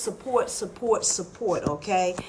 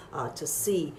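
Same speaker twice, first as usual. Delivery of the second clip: 1 fading whisper; 7 semitones lower, 9 dB quieter than usual. Real, not acted. Host trailing into near whisper.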